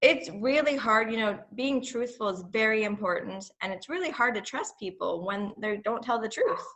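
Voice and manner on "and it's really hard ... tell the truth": usual speaking voice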